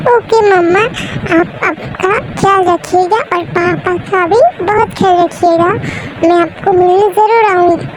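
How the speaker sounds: put-on a little girl bravely waving bye; childlike farewell. Child saying goodbye. (ऊँची, बच्चों जैसी आवाज़ में मम्मा को अलविदा)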